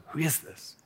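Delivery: hushed, unvoiced private question; whispered inner thought. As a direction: whisper it, as if thinking to yourself. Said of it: "thinking to yourself".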